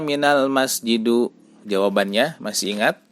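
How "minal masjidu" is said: "minal masjidu" with the last vowel turned to u; wrong u ending. Shown as a demonstration. Teacher showing the misreading, "minal masjidu" ending in u. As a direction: end "minal masjidu" on u instead of i. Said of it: "atau tidak dibaca".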